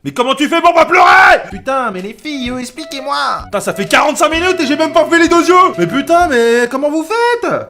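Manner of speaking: loud, high exasperated yelling; exasperated shouting. Real, not acted. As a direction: shout it in frustration. Exasperated at failing makeup.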